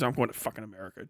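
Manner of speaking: low, hushed, quick quoted aside; fed-up mutter. Retold or imitated. Emigrant sick of fellow Europeans, fast low mutter. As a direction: imitate someone quietly grumbling off.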